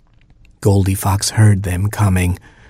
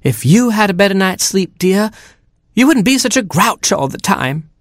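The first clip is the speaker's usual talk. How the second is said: high, chiding hen voice; clucking hen; 12 semitones higher than usual. A narrator's reading. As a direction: nag in a high mother hen voice. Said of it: "clucked Mama Hen".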